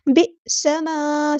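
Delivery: बि समा with a glottal catch, very slow; correct reading. Shown as a demonstration. Teacher showing the right jolt, slow. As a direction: recite बि समा with a sharp hamza stop. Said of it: "यहाँ पे झटका आएगा".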